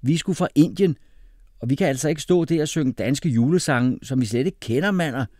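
loud, high-pitched protest; indignant boy refusing; defiant refusal. A narrator's reading. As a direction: dig your heels in against father. Boy refuses father's singing plan.

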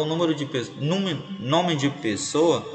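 ordinary talk

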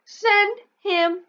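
high, very slow, loud demand; demanding kid. Acted. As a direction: stamp it out word by word. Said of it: "you'll yell".

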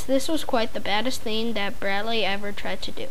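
plain reading voice

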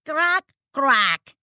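loud crow caws; crow-speak; croaking. Acted. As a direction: caw twice. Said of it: "Teddy lanzó un graznido".

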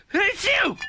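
huge, high-pitched acted sneeze; loud sneeze. A narrator's reading. Ruff with dust on his nose, loud acted sneeze. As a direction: explode into a loud achoo. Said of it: "he sneezed all the biscuits off".

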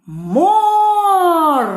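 морква called slowly, high and loud on МОР; long loud call of морква, stretched О. Shown as a demonstration. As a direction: hold the first syllable of морква long and high. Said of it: "Гукаємо".

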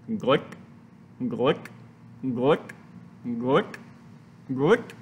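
low glugs, each flicked upward; low rising blobs, evenly spaced; icing noises. A narrator's reading. Reader imitates icing being spread, glicking noises.

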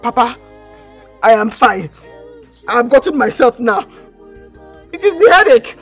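high, loud, urgent insistence; desperate pleading. Acted. Daughter insisting to Papa she is fine, desperate pleading. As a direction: plead loudly to be believed.

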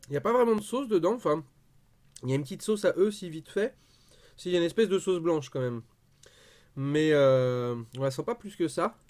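talking between bites of kebab; chewing while talking. Real, not acted. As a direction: mouth partly full of food. Seen 0: Bit kebab at -2s, head down holding it while speaking.

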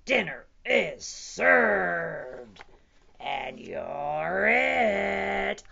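very slow, booming threat; menacing T-Rex. A narrator's reading. T-Rex claims Stanley as dinner, slow booming threat. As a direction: stretch each word into a loud growing menace.

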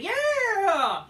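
high, loud mock cheer; mock fan cheer. Vlogger mocking dumb fans cheering, loud and high. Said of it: "the dumb fans like".

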